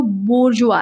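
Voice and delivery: slow, careful बोर्जुआ, bor-zhoo-ah; slow model word बोर्जुआ, bor-zhoo-ah; pronunciation demo. Shown as a demonstration. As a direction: spell out बोर्जुआ slowly for the class, bor-zhoo-ah. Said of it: "इसका जो pronunciation क्या है, इसको याद कर लो".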